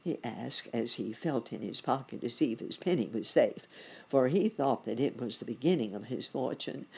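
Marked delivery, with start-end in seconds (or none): none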